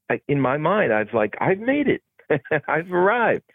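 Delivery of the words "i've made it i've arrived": high-pitched mock-triumphant declaration; retold triumph. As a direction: relive the moment of having made it, with a laugh. Recalls his triumphant thought on being booked.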